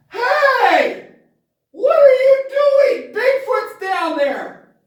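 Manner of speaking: high, loud, slowed warning cry; hammed-up frantic yell; alarmed shout. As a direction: call out to strangers down the path. Yelling at strangers on path.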